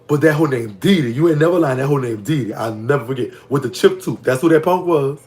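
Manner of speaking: normal talking voice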